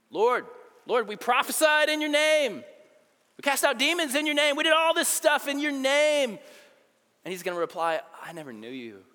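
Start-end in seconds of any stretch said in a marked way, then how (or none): 0.1-6.4 loud, high, insistent protest; pleading cry. Acted. Pleading crowd at Judgment Day. 8.2-9.0 hushed verdict. Acted. Jesus's reply, hushed.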